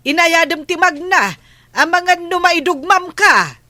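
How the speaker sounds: calling out. Acted. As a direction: shout after her so she hears from afar. Calling out to approaching neighbor. (napigsa nga ayab)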